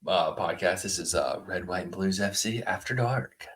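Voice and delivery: low, hushed late-night radio host; after-dark voice. Pretend. Co-host opens the late-night podcast edition, low after-dark voice. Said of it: "your After Dark voice is amazing".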